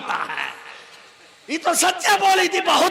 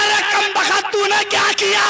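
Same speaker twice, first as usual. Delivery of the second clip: angry scolding; 5 semitones higher than usual. Retold or imitated. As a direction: shout at her for the blunder. Husband scolds wife loudly. (पत्नी को ऊँची आवाज़ में डाँटता पति)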